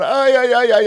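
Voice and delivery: high, hammed-up ay ay ay of an aching back; mock pained wail. Host joking with a caller about his sore back, hammed-up high wail. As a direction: wail like you can't stand up straight.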